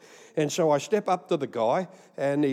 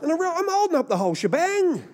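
very high, squeaky mock outcry; hammed-up falsetto wail of realisation; comic exasperation. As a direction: send the voice right up in mock dismay. Comic realisation he delays everyone.

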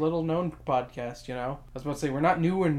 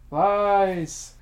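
mock accusing shout; 3 semitones higher, 5 dB louder than usual. Accusing cohost of lying.

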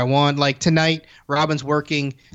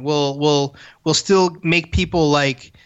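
normal talking voice

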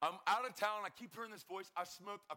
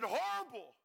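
high, loud exasperated answer; mock-wretched exclamation; exasperated outburst; 6 semitones higher, 6 dB louder than usual. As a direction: blurt it out in misery, acted. Answers how he felt, exclaiming.

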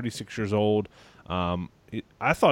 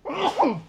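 sneeze. Real, not acted. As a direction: let a big sneeze burst out. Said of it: "Hold on, I'm about to sneeze".

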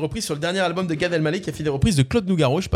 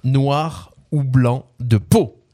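slow, lower spoken verse; lyric recitation; 5 semitones lower, much slower than usual. Acted. Quiz host finishing the clue lyrics, slow low recitation. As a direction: recite it low, stretching out the last line.

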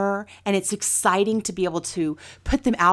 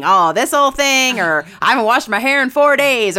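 mock deflecting; 4 semitones higher, 6 dB louder than usual. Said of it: "like Lisa was saying".

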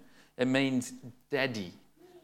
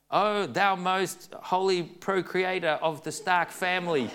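higher, louder, hammed-up old-fashioned reverence; mock solemn kids; 7 semitones higher, 5 dB louder than usual. Kids addressing their dad in mock-solemn old English, hammed up. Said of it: "if they talk like that, it would be silly".

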